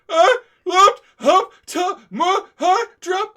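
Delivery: high, slow, loud crying wail; mock wailing. Fan retells wailing over the dropped box, mock crying. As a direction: wail it like a broken-hearted child. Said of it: "I'm losing my shit".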